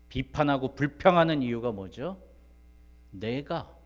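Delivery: ordinary talk, nothing marked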